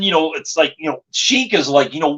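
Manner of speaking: ordinary delivery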